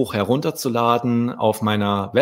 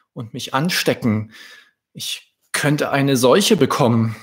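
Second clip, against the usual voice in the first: higher, worried queen thinking aloud; fretting cow queen, higher voice; 5 semitones higher, 3 dB louder than usual. Acted. Cow queen's worried inner thoughts.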